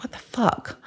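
breathy whispered disbelief; baffled hushed aside. Retold or imitated. Woman recalling her reaction to an odd order, baffled whisper.

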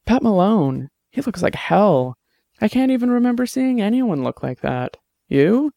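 concerned Annabelle. A narrator's reading. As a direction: lift the pitch, draw out the dismay. Narrator voices Annabelle talking.

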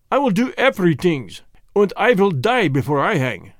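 defiant foreigner in broken English; Germanic-accented fighting threats; Jan with Germanic accent, defiant. Acted. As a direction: refuse stubbornly in a thick Germanic accent. Jan defies his hangmen.

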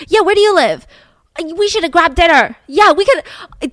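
bubbly, slowing on the last yeah; happy drunk passengers making friends; giddy passengers. Retold or imitated. Tipsy passengers celebrating.

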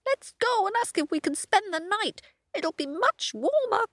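high rooster voice; Cockerel. A narrator's reading. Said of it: "says Cockerel".

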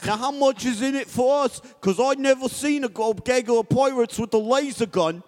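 loud, high British sailor voice; boisterous British sailor calling out. Acted. Sailor urging his parrot to sing, loud British accent. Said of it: "You're a British sailor".

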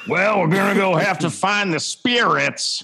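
bad Boston accent as a cartoon alien; terrible Boston accent. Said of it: "terrible, like, Boston accent as Stitch".

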